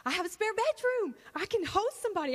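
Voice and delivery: high-pitched, thrilled reenactment; excited. Retold or imitated. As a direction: gush it like a thrilled first-time host. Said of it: "We were so excited".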